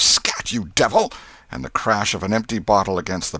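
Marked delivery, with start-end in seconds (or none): 0.0-1.1 raised, higher angry shout; sudden loud outburst; sharp cry. A narrator's reading. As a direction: cry out to chase it away. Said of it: "A cry of".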